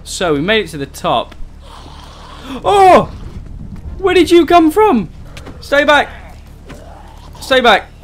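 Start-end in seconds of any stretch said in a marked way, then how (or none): 2.6-5.1 loud, high-pitched shock; startled yell. Real, not acted. Player ambushed by a zombie, startled yell. 5.6-7.9 urgent warning. Real, not acted. Warding off the zombie.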